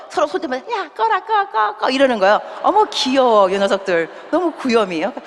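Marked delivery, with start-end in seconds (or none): 0.7-1.9 high-pitched imitation of schoolkids; giggly children. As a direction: mimic excited kids egging each other on, much higher. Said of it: "애들이 막 웃으면서 서로 막". (아이들 흉내 낸 높은 목소리)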